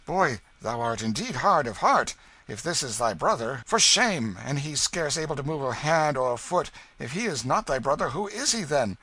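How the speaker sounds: high, loud, shocked reproach; benevolent stranger, scolding. A narrator's reading. Stranger rebuking the boy over his brother, indignant.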